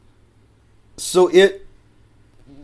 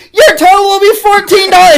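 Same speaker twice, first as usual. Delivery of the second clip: loud shrill drive-thru lady; 15 dB louder than usual. Retold or imitated. Imitating the drive-thru lady blaring over the speaker, high and loud. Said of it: "That's her voice. That's how loud".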